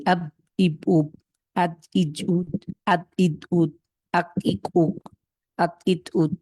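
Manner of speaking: each syllable from আব to উদ stopped on its last consonant; final consonants left unreleased, as in আক, ইক, উক; wrong, without echo. Shown as a demonstration. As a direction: cut আব, আদ, আক, আত off with no bouncing release. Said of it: "এভাবে পড়লে কিন্তু".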